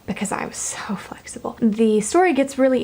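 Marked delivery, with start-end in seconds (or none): 0.1-1.6 whispered, smug aside about her own flexibility; a softer, breathy brag; mock-smug whisper. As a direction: whisper it as a self-satisfied joke.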